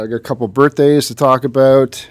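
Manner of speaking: ordinary delivery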